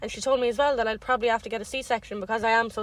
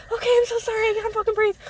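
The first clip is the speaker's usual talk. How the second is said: higher, louder panicked gasping voice; panicked retelling; 10 semitones higher, 4 dB louder, faster than usual. Retold or imitated. To the nurse, panicked and pitched up. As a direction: relive the panic, pitched up and pushed. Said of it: "And I was like".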